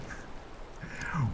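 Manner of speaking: soft, breathy little laugh; quiet chuckle to oneself; short laugh. Real, not acted. Gamer amused after an exciting fight, soft chuckle.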